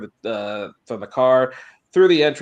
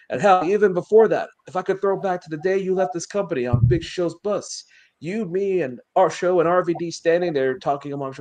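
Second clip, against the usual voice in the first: a touch of impassioned promo delivery, slightly raised pitch; lightly voiced wrestler promo; 7 semitones higher, faster than usual. Wrestler reminding Paul of old promises, lightly acted promo. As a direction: lightly act out a wrestler's appeal.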